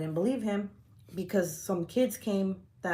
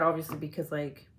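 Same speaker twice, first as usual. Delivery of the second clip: ordinary talk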